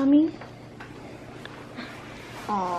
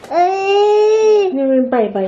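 slow, high, drawn-out comfort; high soothing sing-song; 8 semitones higher, 5 dB louder, much slower than usual. Real, not acted. Consoling crying toddler.

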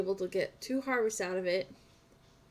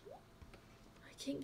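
slow whispered lament; slow whispered regret; 5 semitones higher, 15 dB quieter than usual. Real, not acted. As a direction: breathe it out sadly and slowly.

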